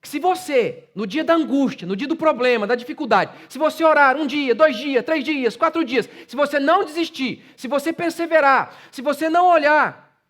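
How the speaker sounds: high, shouted exhortation; preaching at full force. Acted. Pastor challenging his congregation to persevere, preaching at full force. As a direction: pastor daring the church to keep praying.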